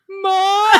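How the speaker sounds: high, loud drawn-out wail; calling out for Mom. Retold or imitated. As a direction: cry out for your mother in mock panic. Calling for mother as water breaks.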